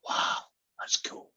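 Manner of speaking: slow hushed whisper of amazement; awed whisper. Acted. The little boy whispers to the pirate in awe. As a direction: breathe it out, slowly, wide-eyed.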